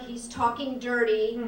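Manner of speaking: ordinary delivery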